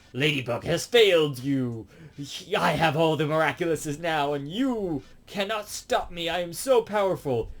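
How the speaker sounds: high, hammed-up supervillain boast; villain proclamation. Hawkmoth gloats to all of Paris, theatrical villain.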